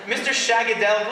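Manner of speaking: normal talking voice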